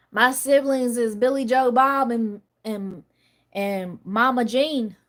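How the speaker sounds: put-on slow, drawn-out Southern country accent. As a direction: imitate Southern siblings, stretching the names. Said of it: "My siblings talk like that".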